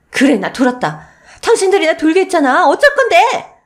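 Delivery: wife screaming defiance at her in-laws; defiant shout. Acted. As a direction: dare them to do anything.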